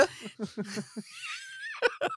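shrieking high laugh. Real, not acted. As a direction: burst into a squealing laugh. Laughing at own impression.

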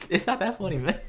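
laughing. Real, not acted. Laughing at own joke.

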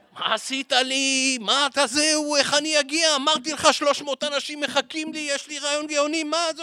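high, strained wail of dismay; panicked Herzl. Acted. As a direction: squeal it like a man who just lost his ride. Herzl panics after the camel ate his car.

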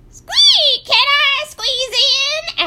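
very high, loud squeaky goat; slow, high-pitched goat voice; bleating goat. A narrator's reading. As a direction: squeal it like a squeezed accordion.

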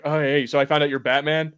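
ordinary talk, nothing marked

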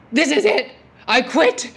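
loud, high-pitched retold outburst. To the administrator, holding the child, a loud retold quitting outburst. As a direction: blurt it out like you've hit your limit.

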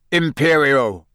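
incantation called out firmly; commanding spell cast. A narrator's reading. Moody casts curse.

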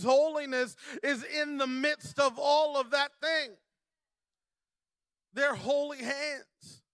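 high, loud preaching with shouted peaks; full-force preaching. Acted. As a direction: drive the message home to the congregation.

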